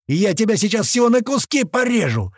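high, loud, furious threat; shouted threat. A narrator's reading. Knifeman threatening to cut up the captive, shouting. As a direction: yell it into his face. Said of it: "закричал ему в лицо Клёпа".

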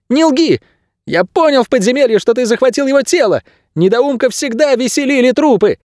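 put-on very high-pitched, worked-up child's voice; agitated boy. A narrator's reading. Boy with a bow accusing Найрод, agitated and high. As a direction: hurl accusations in a high boy's voice.

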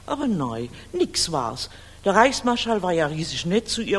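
usual speaking voice

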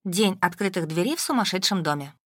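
ordinary delivery

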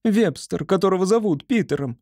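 high, quick upset squirrel. A narrator's reading. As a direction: rush it, high and anxious. Squirrel voice continued.

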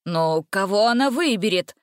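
ordinary delivery

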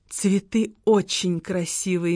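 медленно и мягко, с мечтой в голосе; будто любуется цветами, неспешно; мечтательно. A narrator's reading. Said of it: "мечтательно ответила". (мечтательно, тихо и медленно)

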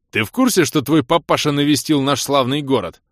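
high, loud accusing question; irate confrontation. Acted. Влас confronts friend about father's visit, loud and irate. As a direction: demand it at full voice.

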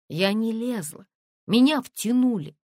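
высоко и решительно, отрезая; возрази резко, с нарастающим напором; резкий отпор. A narrator's reading. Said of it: "отрезала Тонечка". (резко, как отрезала)

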